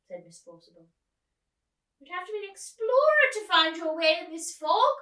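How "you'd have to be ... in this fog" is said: put-on high, loud fluttery old lady calling; a theatrical old actress asking after her friend. Old actress calls out to the girl, high and loud. Miss Forcible voiced high.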